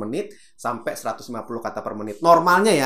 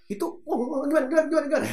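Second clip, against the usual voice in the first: panicked fluster; 13 semitones higher, much faster than usual. Shown as a demonstration. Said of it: "panicked brain imitation".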